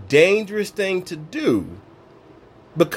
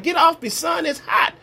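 high, whiny protest at the heat; playful high-pitched grumble at the sun; mock complaint to the sun; 11 semitones higher than usual. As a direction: shoo the sun away like a pest. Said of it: "You're like".

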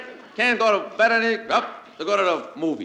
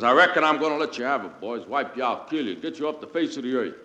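mock cowboy threat; 8 semitones lower, 4 dB quieter than usual. Cowboy Killer threatening villains.

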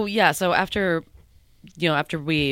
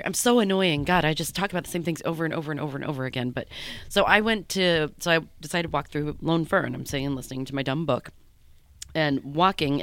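ordinary talk

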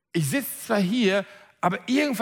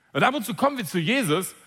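normal talking voice

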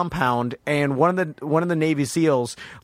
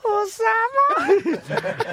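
very high, slow falsetto call; stretched-out high girly call; girl's voice; much slower than usual. Retold or imitated. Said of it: "the guy going".

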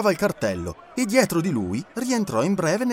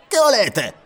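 higher, louder brusque demand; gruff showman; 8 semitones higher, 6 dB louder, slower than usual. A narrator's reading. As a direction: bark at the stranger. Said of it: "chiese a Passepartout".

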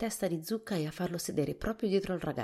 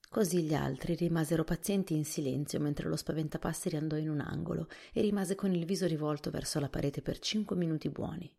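usual speaking voice

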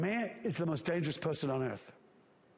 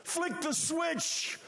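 God's command shout; 11 semitones higher than usual. Acted. God shouting to the angels to turn the sound on. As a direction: bellow the order to flick the switch. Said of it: "once God says".

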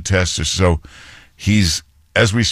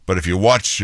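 ordinary talk

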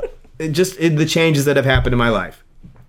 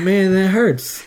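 slow, pitched-up mock wounded whine; mock hurt; 8 semitones higher, much slower than usual. Answering a coworker's teasing, slow mock-hurt whine. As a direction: play the hurt for laughs. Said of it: "I said".

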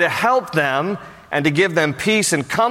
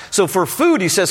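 ordinary talk, nothing marked

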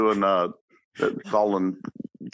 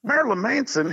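incredulous sergeant. Retold or imitated. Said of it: "he said".

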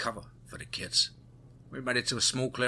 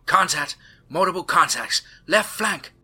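urgent yells, much higher and louder; called out warnings; 9 semitones higher, 10 dB louder than usual. A narrator's reading. Tammy spotting enemies on the flank, urgent yell. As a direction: call out targets in alarm. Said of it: "And Tammy called out".

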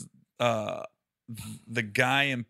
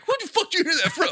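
high, fast, laughing mock outrage; play-angry demand through laughter; mock indignant; 4 dB louder, faster than usual. Telling Brian Callen off by text, laughing mock outrage.

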